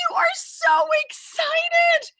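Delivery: very high, drawn-out squeal of excitement; thrilled kids' host squeal; excited squeal. Pretend. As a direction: gush it slowly at a high pitch. Host hyping up child viewers.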